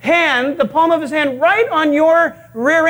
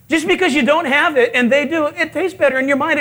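usual speaking voice

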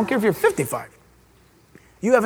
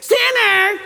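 high laughing caricature of a yell; mock harsh yell; 9 semitones higher than usual. Preacher mocking a harsh judge's yell. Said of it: "He probably didn't say".

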